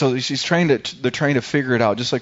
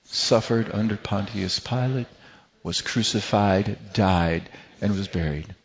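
creed recitation; 3 semitones lower, 4 dB quieter than usual. Acted.

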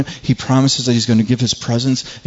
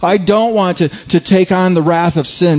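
higher, urgent voicing of a panicked Jesus; panicked plea; 6 semitones higher than usual. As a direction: retell his panic with raised pitch. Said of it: "He's, he's panicked".

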